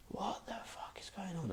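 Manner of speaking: hushed, voiceless disbelief; stunned whisper. Retold or imitated. Baffled man staring at people speaking Hebrew, stunned whisper. Acting out stunned private reaction.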